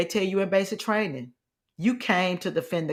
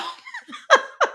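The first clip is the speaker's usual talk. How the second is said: short burst of laughter; brief laugh. Real, not acted. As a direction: laugh it off quickly. Laughs after the joke.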